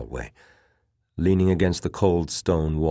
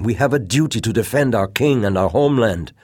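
higher, louder, proud rebuke; brittle disdain; 8 semitones higher, 8 dB louder, faster than usual. A narrator's reading. Officer defending king and homeland, brittle with disdain. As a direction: declare duty with brittle disdain. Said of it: "His voice was brittle with disdain".

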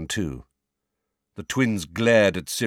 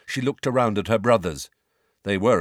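ordinary delivery, nothing marked

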